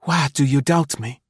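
Marie's hurt, angry demand; angry cry. A narrator's reading. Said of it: "exclaimed with anger".

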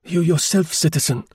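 high, commanding address; sharp, decisive. A narrator's reading. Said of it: "continued in sharp, decisive tones".

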